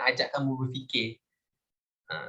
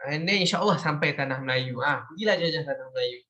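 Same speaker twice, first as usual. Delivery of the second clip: pitched-up mock welcome, "go on, go on"; voicing Thailand. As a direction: playfully act out Thailand making way. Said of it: "Thailand pun macam".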